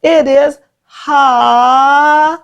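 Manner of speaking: HOLLY stretched long, high in pitch; exaggerated pronunciation. Shown as a demonstration. Teacher revealing a pupil's name to class, exaggerated "holly". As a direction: draw out both syllables of "holly" slowly. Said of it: "And I exaggerate that".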